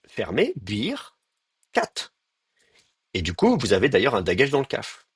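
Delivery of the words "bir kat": "Bir, Kat" said slowly as two separate closed syllables; split into syllables. Shown as a demonstration. Teacher to students, spelling out the syllables slowly. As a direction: break "Bir" and "Kat" apart with a pause. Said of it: "deux syllabes fermées".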